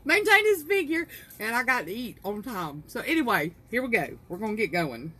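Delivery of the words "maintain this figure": high, loud, giggling squeal of a redo; retry the phrase while still cracking up; giggly restart. Real, not acted. Viewers hear her laughing after a snort, high giggly restart. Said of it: "I done tickled myself".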